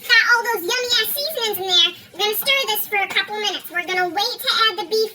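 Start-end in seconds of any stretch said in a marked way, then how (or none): none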